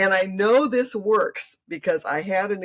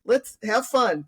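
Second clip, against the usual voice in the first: warm grandmother; 7 semitones higher, 3 dB louder than usual. Retold or imitated. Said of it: "And I was like".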